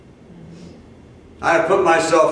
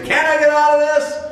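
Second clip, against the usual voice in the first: high, loud exasperated cry to the sky; mock yell; 12 semitones higher, 4 dB louder than usual. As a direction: throw it up high like a tantrum. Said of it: "scream and yell and say".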